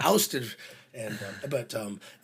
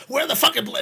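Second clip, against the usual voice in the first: loud, much higher mock shout; angry yell; 14 semitones higher, 10 dB louder than usual. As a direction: yell about the missing bars. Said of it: "the girls yelling again".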